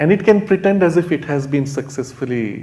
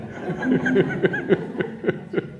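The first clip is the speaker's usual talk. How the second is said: airy chuckle, high; amused laughter; real delighted laugh; breathy laugh; 8 semitones higher than usual. Real, not acted. Laughs at his own point.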